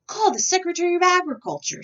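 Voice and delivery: high, loud call across the house; stuck president yells for his secretary; shouted order. A narrator's reading. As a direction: yell the summons from the tub. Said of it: "shouted President Taft".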